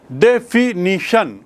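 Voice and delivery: डेफिनिशन slowly broken into four beats; syllable by syllable. Shown as a demonstration. Teacher counting vowel sounds, डेफिनिशन in separate syllables. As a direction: say डेफिनिशन with every syllable set apart. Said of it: "चार बार बोला गया".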